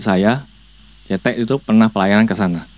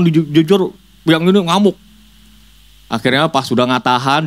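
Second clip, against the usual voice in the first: ordinary talk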